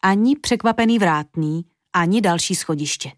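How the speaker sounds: usual speaking voice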